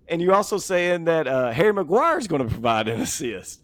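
high, loud mock disbelief; incredulous bookie. Bookie reading back a caller's absurd bet, incredulous.

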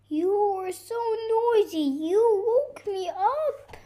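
high-pitched, upset wail; tearful complaint. Acted. Woken bear complains to noisy animals, tearful wail. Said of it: "cried the bear".

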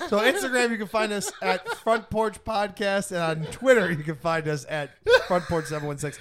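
loud, laughing announcement. Shown as a demonstration. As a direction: proclaim it loudly through laughter. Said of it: "let's fact check that".

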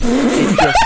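bursting into laughter mid-word; laughing. Real, not acted. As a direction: laugh breaks through the words.

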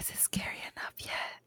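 spooky whisper. Acted. Spooky whisper teasing co-host.

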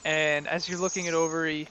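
usual speaking voice

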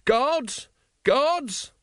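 high-pitched cry for the guards; calling out. A narrator's reading. Usurper calls in his palace guards, high-pitched call. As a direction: summon them with a long, high call.

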